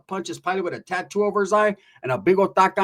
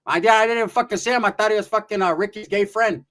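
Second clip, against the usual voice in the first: ordinary talk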